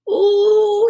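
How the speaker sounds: high, thrilled gasp of wonder; delighted ooh. Acted. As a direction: marvel at the view. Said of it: "she cried".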